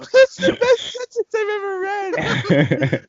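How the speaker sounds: high, slow, wheezing through laughter; can barely get it out for laughing. Real, not acted. Reader cracking up at the fanfic line, laughing.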